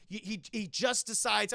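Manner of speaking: ordinary delivery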